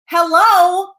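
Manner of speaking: high, loud sarcastic hello. Acted. Mocking people who send nudes, loud sarcastic hello. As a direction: call it out like they're clueless.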